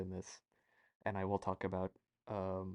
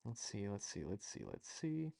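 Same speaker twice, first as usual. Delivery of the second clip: muttering to self; 7 dB quieter than usual. Real, not acted. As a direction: hum it to yourself while searching.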